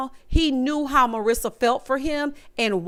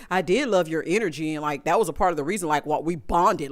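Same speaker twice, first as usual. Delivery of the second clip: lower mock of Ramses; 7 semitones lower, faster than usual. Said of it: "he sits there and says".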